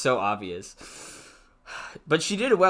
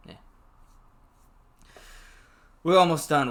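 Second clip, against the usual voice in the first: ordinary delivery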